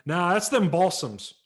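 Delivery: rough lot salesman; dismissive salesman. Retold or imitated. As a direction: wave it off. Seller dismisses.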